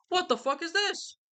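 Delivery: squeaky high, slow outraged what; a prince stunned at the bodies; high-pitched disbelieving yelp; shocked prince. Retold or imitated. Prince arrives at the deaths.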